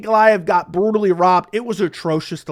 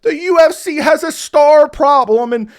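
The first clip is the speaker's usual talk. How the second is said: higher, louder mock whine about the star problem; mocking whiner; 6 semitones higher, 6 dB louder than usual. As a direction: parody a whining pundit, a bit higher. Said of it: "I've seen Ariel crying and whining".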